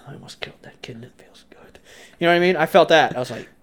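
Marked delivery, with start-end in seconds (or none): 0.1-1.8 breathless gleeful whisper. Retold or imitated. Boy savoring a dark thought to himself, whisper. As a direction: confide it in a hush.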